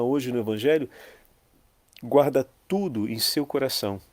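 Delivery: ordinary talk, nothing marked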